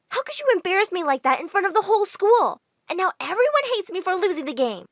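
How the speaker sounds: 1 angry complaint. Retold or imitated. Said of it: "I was so mad at Mom".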